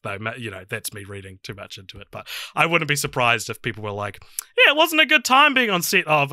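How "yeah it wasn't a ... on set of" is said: high, loud mock testimony; imagined complaint. People from the film set complaining, mock complaint. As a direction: voice someone griping, pitched way up.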